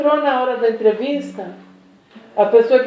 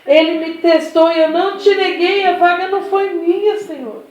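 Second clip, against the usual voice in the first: acted-out indignant complaint, pitched up; revolted; 5 semitones higher than usual. Woman who lost the job complains to the Lord, acted revolted. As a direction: protest like a wronged believer, voice raised in pitch. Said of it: "ela ficou revoltada".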